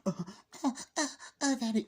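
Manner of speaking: mock orgasmic moans, climbing; mock high moaning. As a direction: moan it up high like a bad porn parody. Mocking sex scene moans.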